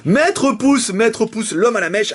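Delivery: high-pitched mock-grand announcement; hyping up a recurring character; playful character introduction. Introduces his exceptional character.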